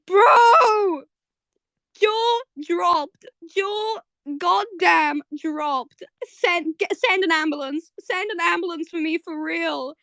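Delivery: high, emphatic exclaiming, starting on a shout; floored fan outburst. Real, not acted. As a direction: cry out high as if you can't cope. Floored fan calls for an ambulance.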